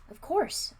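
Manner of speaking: sharp and impatient; a sharp quick answer. A narrator's reading. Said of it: "I said sharply".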